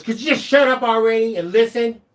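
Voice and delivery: loud, high, snapping rude customer; mock the rude lady telling him to shut up. Retold customer snapping at the server, loud and high. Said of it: "and the lady goes".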